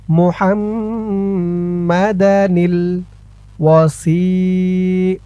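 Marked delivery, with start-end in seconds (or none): 0.1-3.0 slow teaching demo of মুহাম্মাদা নীল, the nun joined to নীল; careful slow demo. Shown as a demonstration. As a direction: spell out মুহাম্মাদা নীল slowly so the nun is heard. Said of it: "আমরা এখানে উচ্চারণ করব মুহাম্মাদা নীল". (ধীরে, নুন মিলিয়ে উচ্চারণ)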